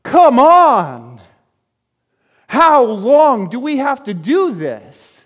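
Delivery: loud, fed-up cry of frustration; bursting out like a worn-out parent; loud exasperated "Come on!", then slow and drawn out; exasperated outcry. Acted. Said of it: "here's Jesus in and amongst his generation going".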